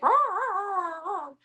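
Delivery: imitating a screaming dog at the dryer; mock roaring. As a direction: ham up her roaring. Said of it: "She used to scream".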